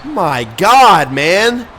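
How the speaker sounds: high, loud amazed call of the catch; loud, high gamer hype; excited outburst. Real, not acted. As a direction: shout it out in disbelief at the play. Watching a big catch.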